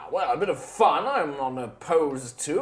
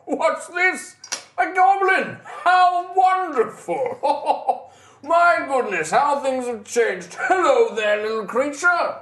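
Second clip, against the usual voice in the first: booming, delighted high voice; hearty nobleman voice, amused; jovial big man voice; 10 semitones higher, 4 dB louder, slower than usual. Acted. GM voices large man greeting goblin.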